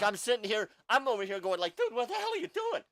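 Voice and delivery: high, loud retold outburst; alarmed protest. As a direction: build to a high mock yell of disbelief. Retold panicked protest at bar.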